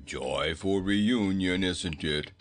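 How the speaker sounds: low, slow, sardonic goat voice; a bored beast sneering; grumpy talking goat. Acted. Goat Bilbil scoffing at the weeping family, low and slow.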